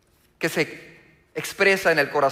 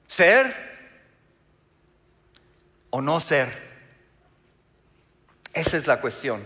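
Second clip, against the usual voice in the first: pondering Hamlet; 4 semitones lower than usual. Acted. Preacher quotes Hamlet's dilemma to the congregation, lightly dramatic pondering. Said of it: "Y este hombre decía".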